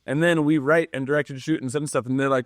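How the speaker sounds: ordinary talk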